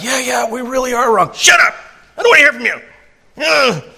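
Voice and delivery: high, loud, whiny tantrum; petulant shouting. Pretend. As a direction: shout down your own conscience like a brat. Sinner shouts down his conscience.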